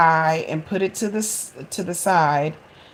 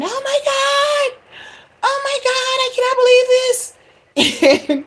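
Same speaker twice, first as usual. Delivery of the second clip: high, loud panicked screaming; panicked yell; 6 dB louder than usual. Retold or imitated. Mother yelling in panic at the smoke-filled house. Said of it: "I'm yelling".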